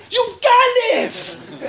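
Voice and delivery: very high, loud, drawn-out yell; mock accusing shout. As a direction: hurl the insult and stretch it out.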